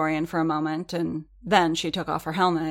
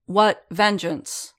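called out; 3 semitones higher, 5 dB louder, much slower than usual. A narrator's reading. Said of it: "called a tall man".

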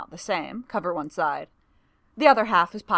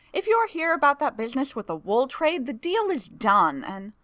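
higher, hurried brush-off; dismissive clerk voice; 7 semitones higher than usual. A narrator's reading. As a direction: wave him away.

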